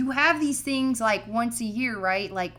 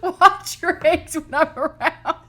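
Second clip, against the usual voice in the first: slow mock threat, laughing; tough-guy warning through laughter; 6 semitones higher, 7 dB louder, slower than usual.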